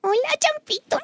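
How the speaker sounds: put-on high squeaky baby talk. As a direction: coo it like a toddler. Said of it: "[name] habla con voz de bebé".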